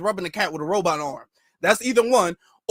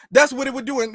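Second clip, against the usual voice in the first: ordinary talk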